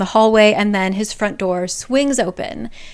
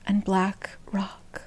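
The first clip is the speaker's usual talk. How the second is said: whisper; 10 dB quieter, much slower than usual. A narrator's reading. Bleak ending of the dream.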